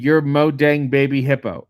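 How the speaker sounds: ordinary delivery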